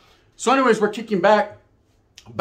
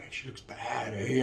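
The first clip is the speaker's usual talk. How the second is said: much softer, low murmured aside; hushed aside; 6 semitones lower, 12 dB quieter, slower than usual. Retold or imitated. Homeboy murmuring to speaker about the girl, hushed. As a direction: keep it from the girls in back.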